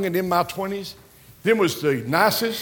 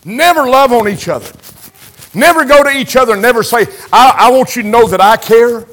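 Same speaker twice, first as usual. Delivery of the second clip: louder, higher, rising to a shout; preaching at full force; 5 semitones higher, 10 dB louder than usual. Acted. Preacher scolding churches that never show love, full-force preaching. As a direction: push it out like a revival sermon. Preacher climbs to a loud peak.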